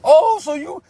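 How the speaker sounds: high, put-on taunting voice; squeaky bravado of a junkie; high mock tough guy. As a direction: play the fake hard dope fiend. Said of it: "Shane comes out".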